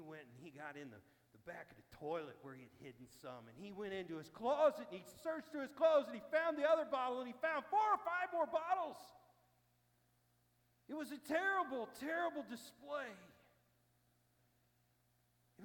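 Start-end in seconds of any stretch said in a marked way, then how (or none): 3.5-13.1 much higher and louder, building to the reveal; rising excitement. Real, not acted. Preacher telling friends of the hidden bottles, much higher and louder. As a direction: tell it like the shocking climax. Climax of bottle search story.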